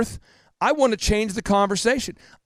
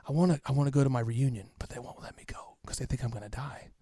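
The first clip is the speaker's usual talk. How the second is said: imitating a faint raspy whisper, much softer; soft, barely there imitation of a raspy whisper; dad's raspy whisper; 5 semitones lower, 10 dB quieter than usual. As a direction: rasp it out like a sick man who can barely talk. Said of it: "he could barely whisper".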